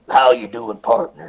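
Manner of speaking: low, grizzled Western greeting; deep mock cowboy voice. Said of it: "I would actually be more grizzled".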